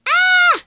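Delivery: sudden high-pitched shriek of pain; scream. Acted. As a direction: shriek as the cat gets hit. Cat attacked, screams.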